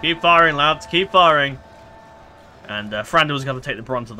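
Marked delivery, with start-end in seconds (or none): 0.0-1.6 rallying shout. Real, not acted. Urging troops in battle.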